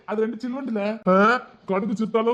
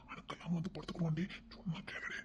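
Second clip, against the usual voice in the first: hushed coaxing whisper; 15 dB quieter than usual. Acted. Husband whispering to his wife at night while family sleeps. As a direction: breathe it so the house stays asleep.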